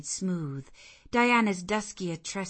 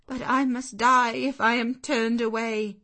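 higher, louder despairing cry; desperate plea; 6 semitones higher, 8 dB louder than usual. Acted. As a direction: beg for her life.

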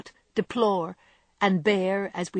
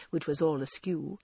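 ordinary delivery

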